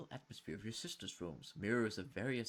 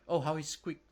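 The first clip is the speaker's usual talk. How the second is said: louder, higher cruel relish; gloating; 6 semitones higher, 9 dB louder than usual. Acted. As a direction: savour the memory of his pain. Jennifer gloats over cruelty.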